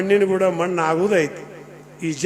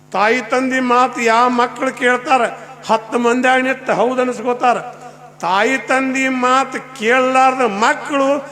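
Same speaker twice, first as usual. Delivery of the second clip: preaching verse; 5 semitones higher, 3 dB louder than usual. Acted. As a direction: proclaim it to the crowd. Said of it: "ನಿಮ್ಗೊಂದು ಹೇಳ್ತೀನಿ".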